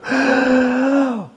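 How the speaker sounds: a big horrified gasp; loud shocked gasp. Acted. Gasps in shock at the confession, loud and sharp. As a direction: suck in air in shock.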